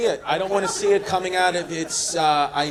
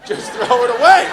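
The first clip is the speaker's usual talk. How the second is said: louder mock plea, rising at the end; exasperated plea; 6 semitones higher, 10 dB louder than usual. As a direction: push it out, fed up. Pleading to discard bottle.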